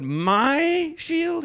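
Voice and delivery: MY drawn out high and very loud; furious demand. Acted. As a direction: roar MY as an outraged challenge, stretched out. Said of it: "he demanded furiously".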